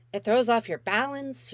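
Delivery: plain reading voice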